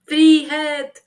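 high, loud cry of freedom; prisoner's defiant shout. A narrator's reading. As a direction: bellow it from the cell. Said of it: "ropte Tyttebær".

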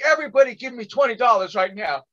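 high, insistent preacher's order; preacher commanding crowd. Retold or imitated. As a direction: imitate a preacher rallying a congregation.

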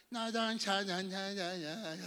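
lightly voiced old granny without teeth; toothless old lady. Pastor mimicking a toothless old church lady, lightly gummy. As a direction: mumble it like a toothless grandma. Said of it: "sin dientes".

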